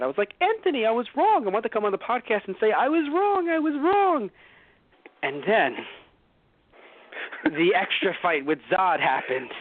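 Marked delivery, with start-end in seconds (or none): 0.4-4.3 high, sing-song admission; mock confession. Admitting to Anthony he was wrong, high and hammed-up.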